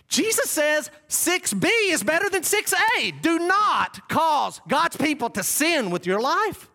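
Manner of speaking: very high, loud pulpit cry; impassioned preaching. Real, not acted. Preacher warning congregation not to cause sin, impassioned preaching. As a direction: cry out the warning at full force.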